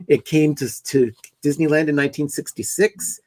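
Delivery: normal talking voice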